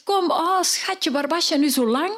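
high, tender mother's welcome. Acted. Mother greets her found daughter, high and tender. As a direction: welcome your child back, high and loving. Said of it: "De mama zegt".

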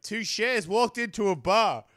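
loud, high, slowed [name] delivery after the correction; [name] joke voice. Pretend. Comedian restarting his [name] joke for the stream, loud. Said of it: "I can do share".

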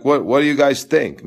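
ordinary delivery

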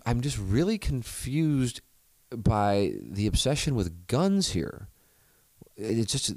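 mildly voiced older golfer. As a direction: lightly act out the bemused older man. Retold overseas golfer's puzzled remark.

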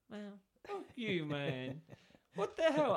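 retold outrage. Smug customer got refund anyway.

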